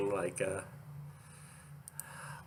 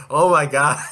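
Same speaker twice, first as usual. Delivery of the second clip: higher, much louder exclamation; mock-shocked outburst; 5 semitones higher, 12 dB louder than usual. Vlogger exclaiming over two characters together, mock-shocked and loud.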